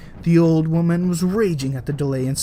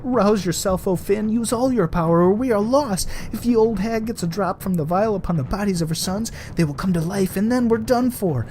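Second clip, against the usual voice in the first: calling out; 7 semitones higher than usual. A narrator's reading. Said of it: "Bran called out".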